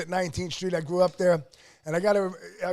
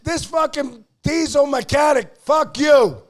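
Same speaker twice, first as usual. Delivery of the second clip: loud angry rant, higher pitched; 4 semitones higher, 8 dB louder than usual. Real, not acted. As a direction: tear into the critic. Ranting at critic online.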